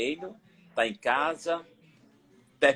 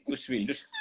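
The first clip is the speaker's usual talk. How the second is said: laughing it out high and breathy; a real laugh remembering sibling fights; 12 semitones higher, 5 dB quieter than usual. Real, not acted. Laughing while recalling ten children squabbling at home.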